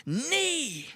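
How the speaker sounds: put-on high drawn-out toddler call; imitated toddler demand. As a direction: pitch it up like an insistent little boy. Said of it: "he looks right in my eyes and he just says".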